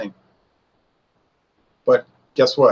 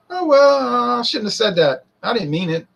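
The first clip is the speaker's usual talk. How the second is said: higher, offhand shrug of a reply; lightly voiced Trump; 9 semitones higher than usual. Pundit lightly imitating Trump brushing it off. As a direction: toss it off lightly, a bit higher. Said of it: "But Trump just said".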